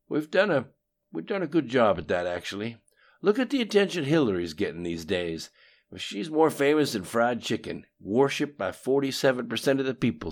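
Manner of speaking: lightly voiced cocky politician; Bill's voice. A narrator's reading. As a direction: brag about old schemes, loud. Bill brags about Hillary.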